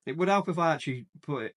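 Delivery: normal talking voice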